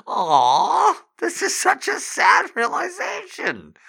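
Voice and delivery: very high, loud wailing Statham parody; hyperbolic Jason Statham. Acted. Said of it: "A really".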